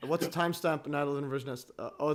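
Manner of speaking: ordinary delivery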